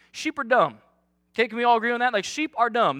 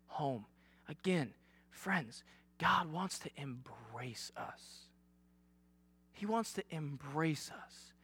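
quiet earnest preaching; 5 semitones lower, 8 dB quieter than usual. Real, not acted. Preacher to congregation, soft sincere reflection. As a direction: lower and hush for the congregation.

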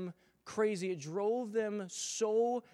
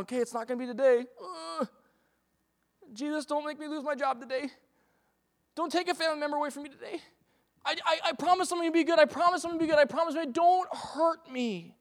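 mock pleading; 6 semitones higher, faster than usual. Fearful believer begging God.

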